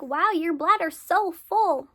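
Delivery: mock amazed tech. Ultrasound tech marvelling at the bladder, loud slow mock wonder. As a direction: ham up the oblivious tech's amazement. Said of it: "she's like".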